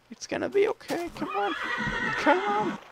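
put-on very high, soft baby-talk coaxing. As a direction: coax it like a nervous pet. Coaxing stubborn unicorn pet.

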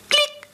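a sharp, high "Klick" sound; imitated click. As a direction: snap out the click like metal. (hoch nachgeahmtes Klicken)

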